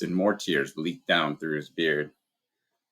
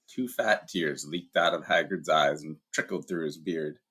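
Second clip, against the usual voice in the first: ordinary delivery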